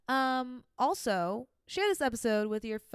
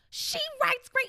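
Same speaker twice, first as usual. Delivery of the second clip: mocking fan voice; 5 dB louder than usual. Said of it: "I can feel people being like".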